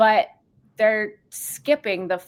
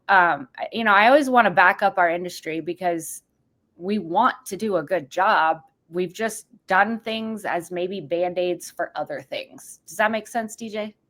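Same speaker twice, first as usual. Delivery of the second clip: normal talking voice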